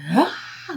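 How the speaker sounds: a short high wailing "Ah!" like crying; retold sob, mildly acted; mock crying wail. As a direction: imitate bursting into tears. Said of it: "Like, crying".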